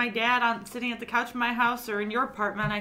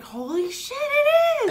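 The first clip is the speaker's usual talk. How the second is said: surprised; 3 dB louder, much slower than usual. Retold or imitated. Retold surprised realization.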